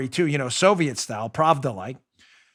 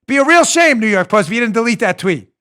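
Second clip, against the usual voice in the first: mob enforcer; 6 semitones higher, 7 dB louder than usual. Retold or imitated. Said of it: "So Twitter's doing the".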